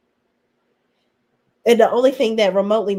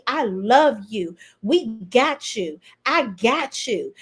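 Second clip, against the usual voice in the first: slow mock comfort; slower than usual. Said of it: "She was like".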